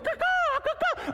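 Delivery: very high laughing caw-caw squawks while flapping; mock bird caws. Pastor mocking arm-pumping drill to congregation, very high laughing caws. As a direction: squawk like a bird, cracking yourself up. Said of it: "I can be like".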